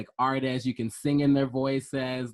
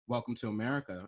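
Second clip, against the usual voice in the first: softer, less color in the voice; toned-down greeting voice; 6 dB quieter, faster than usual. Retold or imitated. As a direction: drain the color out, a careful understated greeting. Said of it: "less color in the voice".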